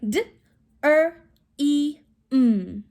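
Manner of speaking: "D, er, e, m" with gaps between sounds; sounded out. Shown as a demonstration. Teacher counts out sounds in dream, segmented. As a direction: break "dream" into four single sounds. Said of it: "Count out your sounds in dream".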